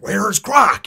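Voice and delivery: high, loud angry yell; frustrated shout. Retold or imitated. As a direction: yell it, fed up and demanding. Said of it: "he's getting really frustrated".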